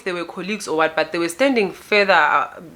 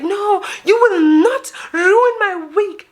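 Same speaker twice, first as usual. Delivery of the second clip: very high, slow wail; mock crying woman; 12 semitones higher, 4 dB louder, slower than usual. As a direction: imitate her tearful protest, stretched out. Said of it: "She starts crying".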